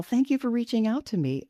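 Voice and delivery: normal talking voice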